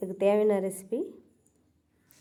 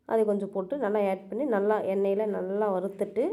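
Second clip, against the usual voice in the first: ordinary delivery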